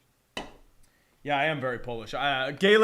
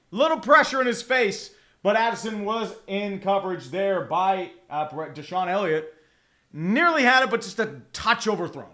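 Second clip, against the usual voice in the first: brisk football call, louder as the pass flies; live play-by-play; 5 dB louder than usual. Real, not acted. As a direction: punch the big moment, then settle.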